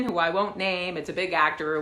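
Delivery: ordinary talk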